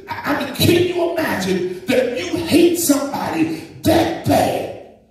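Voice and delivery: rising fervent sermon cadence; full-force preaching. Acted. Preacher to congregation, fervent preaching. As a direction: preach it out, building the fire.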